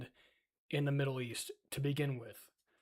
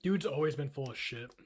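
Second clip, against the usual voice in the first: ordinary delivery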